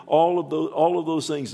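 fervent preaching. Real, not acted. Preacher stirring the congregation, fervent and loud. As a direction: lift the church with it. Preacher rising to full force.

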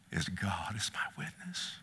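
low, whispered solemn oath; hushed testimony. Real, not acted. Preacher testifies of his healing, hushed. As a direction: swear it in a hush.

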